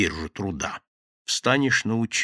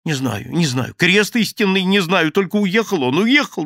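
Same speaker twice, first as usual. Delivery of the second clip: frightened mumbling; 7 semitones higher, 5 dB louder than usual. A narrator's reading. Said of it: "бормотал Шеренец".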